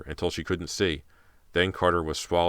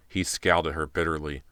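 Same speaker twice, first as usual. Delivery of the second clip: plain reading voice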